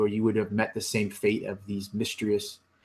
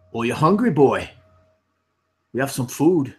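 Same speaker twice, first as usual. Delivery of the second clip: hearty offer; 3 semitones higher, 5 dB louder than usual. Acted. Krim offers food heartily.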